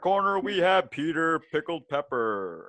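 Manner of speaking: booming ring announcer, stretched out; wrestling announcer. Pretend. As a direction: hype the fighters like a ring announcer. Mock wrestling ring announcer intro.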